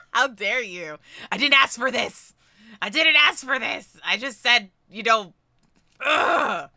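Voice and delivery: loud, overwhelmed playful protest; exasperated, loud, hammed-up indignation; mock outrage. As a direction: scold the generous viewers in pretend anger. Mock outrage at generous viewers.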